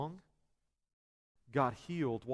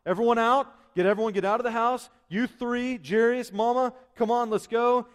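loud, higher, brisk orders to clear the room; Jesus ordering the mourners out, loud and urgent; commanding; 6 semitones higher, 6 dB louder than usual. Acted. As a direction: herd everyone out of the house firmly.

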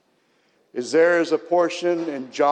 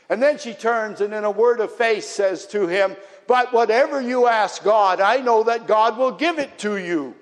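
raised preaching voice; 5 semitones higher, 3 dB louder than usual. Acted. Preaches Martha's dialogue with Jesus.

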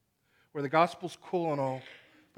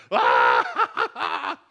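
mock laughing shout; a mother's gleeful gotcha cackle; mom's cackling laugh; 5 dB louder than usual. Prank-playing mom laughing at her toddler, loud mock cackle. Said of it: "the mom's like".

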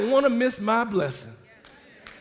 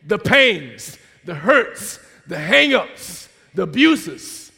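loud, driving sermon delivery; loud, punchy exhortation; forceful preaching; 8 dB louder than usual. Real, not acted. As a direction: preach it hard to the congregation.

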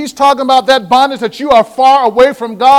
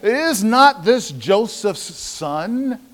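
doubting murmur; 2 semitones lower, 7 dB quieter, much slower than usual. Retold or imitated. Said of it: "And they said".

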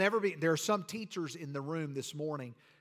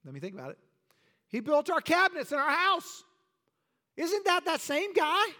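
higher, louder gossiping neighbour voice; astonished townsfolk; 10 semitones higher, 4 dB louder than usual. Acted. Hometown neighbours doubting Jesus, higher and louder incredulous. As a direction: play the hometown crowd doubting him.

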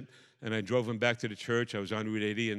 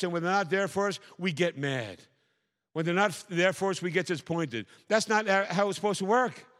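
ordinary talk, nothing marked

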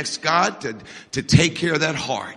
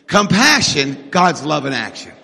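ordinary talk, nothing marked

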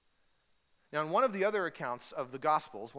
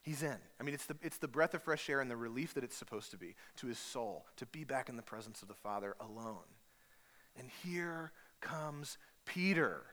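normal talking voice

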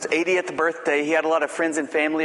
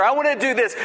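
higher, mocking sing-song; mocking silly talk; 8 semitones higher, 4 dB louder, faster than usual. Preacher mimics churchgoers' grand claims, higher and mocking. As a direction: send up a lofty boast. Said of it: "silly things like".

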